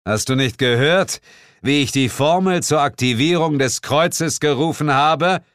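loud, high, emphatic question; self-important boast. Acted. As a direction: make sure all hear your heroism. Boasting to his colleague.

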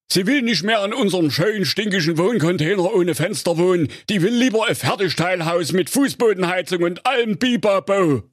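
high, fast husband voice; henpecked husband. A narrator's reading. Husband listing his wife's wishes, high fast voice. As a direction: rattle off her demands.

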